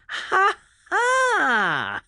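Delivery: very high shouted "Ha ha!" then breathy laughter; triumphant yell and laugh. A narrator's reading. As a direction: crow with glee. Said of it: "The voice yelled and started to laugh".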